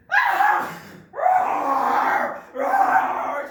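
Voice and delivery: huge high roaring yell; furious bear roar. Acted. Bear discovering his shrunken fur, roaring loud. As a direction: roar in shock and fury.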